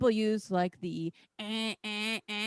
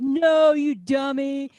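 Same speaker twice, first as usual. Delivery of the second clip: mocking locals; 4 semitones higher, 7 dB louder, slower than usual. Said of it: "And they'd be like".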